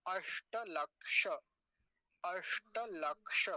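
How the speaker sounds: slow अष्टलक्ष with long retroflex sh; word model. Shown as a demonstration. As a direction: hold the ष hiss and final a in अष्टलक्ष. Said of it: "अब अगला शब्द देखिए".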